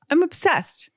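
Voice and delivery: put-on high gushing valley girl voice; bubbly sorority girl. Shown as a demonstration. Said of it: "That would be the word obsessed".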